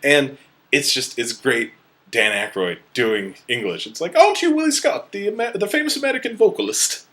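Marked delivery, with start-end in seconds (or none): 4.2-7.1 high, rapid posh British accent, imitating the travel agent. Travel agent recognising Willie Scott at the airfield, posh British accent. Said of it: "a very posh British accent".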